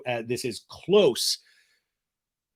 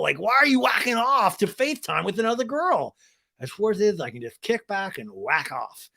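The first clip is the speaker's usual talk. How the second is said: Sandoval impression; 4 semitones higher than usual. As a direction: rant as a caught-out whiner, pitch jumping high. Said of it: "his big lie has been found out".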